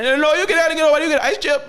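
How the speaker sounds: imitating the nurse. Nurse refusing the patient water, high bossy mock voice. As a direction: snap back like the rowdy nurse.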